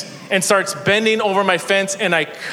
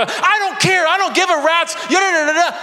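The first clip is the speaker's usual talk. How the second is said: cutting off neighbor in a high angry yell; 12 semitones higher than usual. Retold or imitated. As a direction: shout him down. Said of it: "I was swearing".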